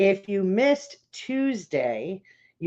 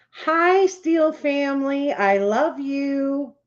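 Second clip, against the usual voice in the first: excited greeting; 7 semitones higher, 3 dB louder than usual. Real, not acted. Greeting a family joining stream.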